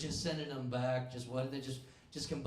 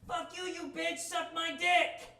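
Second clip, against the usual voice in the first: screeched far higher, loud and slow; cursing old abuelita; 6 dB louder, slower than usual. Retold or imitated. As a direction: screech it like a furious old grandma. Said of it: "she likes to say things like".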